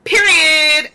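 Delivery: high, loud drawn-out declaration; loud mock-emphatic sign-off; imitated catchphrase. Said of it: "she was like".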